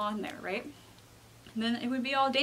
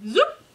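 short, high, loud zoop; zoop sound effect; 11 semitones higher, 9 dB louder than usual. A narrator's reading. The Replicator copies Alpaca, sudden rising zoop. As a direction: pop out a rising machine noise. Machine noise in the story.

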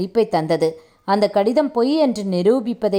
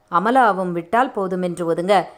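ordinary delivery

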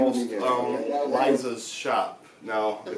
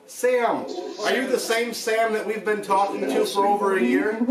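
raised voice calling out to a spirit; 9 semitones higher than usual. Real, not acted. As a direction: call out into the empty room.

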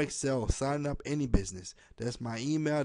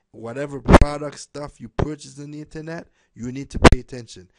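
much louder, pressing demand to pay attention; urgent loud appeal; 12 dB louder than usual. Real, not acted. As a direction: raise the voice to grab attention. Urging viewers to pay attention.

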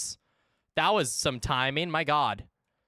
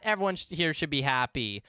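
ordinary talk

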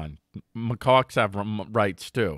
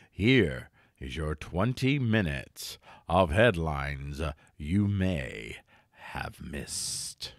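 announcer voice; 6 semitones lower, 7 dB quieter, much slower than usual. Acted. Show intro announcer read.

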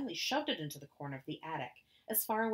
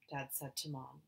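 usual speaking voice

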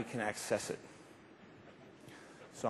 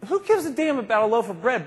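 louder and higher, laughing through it; laughing outburst; 8 semitones higher, 6 dB louder than usual. Real, not acted. Speaker laughing at his own bread example, loud and high. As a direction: burst out laughing at the absurdity.

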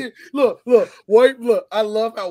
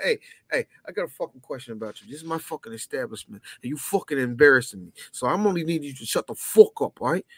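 bar owner calming down; 5 dB louder than usual. Acted. Acts Italian owner calming, threatening.

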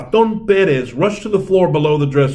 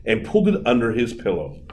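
usual speaking voice

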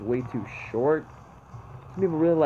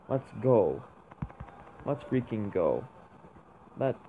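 ordinary delivery

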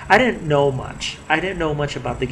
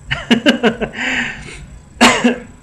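burst of laughter; 9 semitones higher, 4 dB louder than usual. Real, not acted. Laughs at own rambling.

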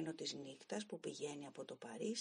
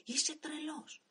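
1 shouting; 10 semitones higher than usual. Acted. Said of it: "του φώναζα διαρκώς".

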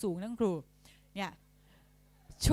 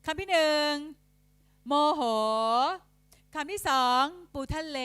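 raised, louder classroom announcing; dictation voice; 4 semitones higher, 5 dB louder than usual. Shown as a demonstration. Teacher dictating example words to pupils, dictation voice. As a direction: call out each item for pupils to write. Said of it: "อ่ะ สมมติคำที่หนึ่ง".

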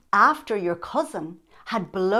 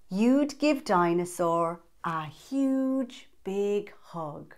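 plain reading voice

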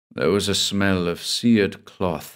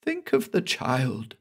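high, softer hoarse urging; hoarse insistence; 5 semitones higher, 5 dB quieter than usual. Acted. Cassandra insists to her lover, hoarse and softer. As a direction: press it in a rasped half-voice. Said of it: "Cassandra insisted hoarsely".

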